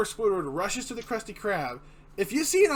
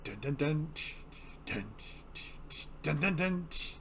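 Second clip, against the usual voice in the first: quiet low beatboxed beat; beatbox the drum track; beatbox imitation; 3 semitones lower, 7 dB quieter than usual. Shown as a demonstration. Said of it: "they add that".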